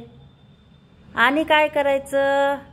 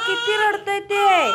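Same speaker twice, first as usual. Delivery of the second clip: fond laugh while speaking higher; amused laughing talk; 7 semitones higher than usual. Real, not acted. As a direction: chuckle through the words. Laughs at crying baby.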